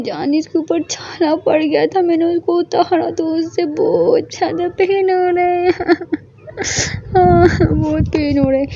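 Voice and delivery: high teary voice breaking into a sob; crying complaint. Acted. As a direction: complain of the pain while crying. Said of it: "फूट फूट के रोने लगी". (रोते हुए ऊँची आवाज़ में शिकायत)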